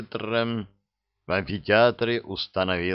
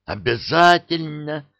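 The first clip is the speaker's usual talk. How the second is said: loud; 8 semitones higher, 5 dB louder than usual. A narrator's reading. Restraining the vampire.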